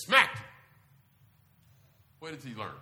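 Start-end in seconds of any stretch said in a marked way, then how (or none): 0.1-0.4 loud, high punch on smacked; sudden emphatic burst. Retold or imitated. Preacher acting out dad's smack to the congregation, sudden loud burst. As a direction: hit it suddenly, much louder.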